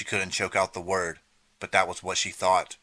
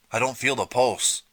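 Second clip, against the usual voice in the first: higher, louder alarmed words; alarmed report; 6 semitones higher, 4 dB louder than usual. A narrator's reading. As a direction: report it shaken. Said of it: "he reported".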